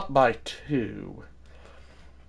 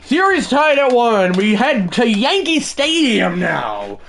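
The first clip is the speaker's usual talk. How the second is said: bright broadcaster read, a touch higher; sports-show segue; announcer intro; 8 semitones higher, 3 dB louder than usual. Acted. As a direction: set the scene like a TV host. Broadcast-style segment intro.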